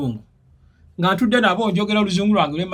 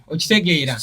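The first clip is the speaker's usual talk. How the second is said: ordinary talk, nothing marked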